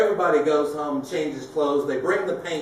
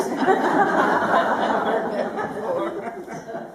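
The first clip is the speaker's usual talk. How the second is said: laugh; 7 semitones higher than usual. Real, not acted. Laughs after punchline.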